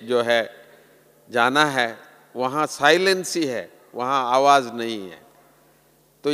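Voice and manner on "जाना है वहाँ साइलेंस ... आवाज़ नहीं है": usual speaking voice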